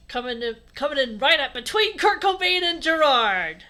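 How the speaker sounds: hammed-up, belted finish; building loud outburst. Reading bursts into loud high delivery.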